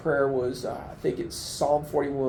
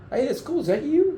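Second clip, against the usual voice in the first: much higher, chirpy mimicked passerby; curious passerby; 13 semitones higher than usual. Man retells volunteers looking at his pictures, high curious passerby voice. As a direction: imitate a passerby's high-pitched, casual curiosity. Acts out nosy passersby's question.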